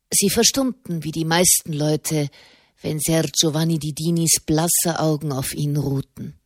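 ordinary delivery, nothing marked

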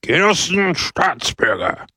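high, ringing orator's call; shouted address. A narrator's reading. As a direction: hail the crowd like a demagogue. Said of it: "schrie Pepone".

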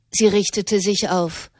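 plain reading voice

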